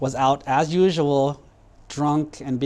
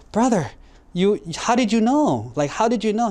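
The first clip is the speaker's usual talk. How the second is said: much higher, astonished questions; amazed brother; 7 semitones higher than usual. Acted. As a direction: gasp it out in disbelief. Brother amazed after finding frog.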